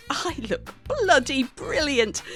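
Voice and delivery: usual speaking voice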